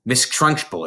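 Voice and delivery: plain reading voice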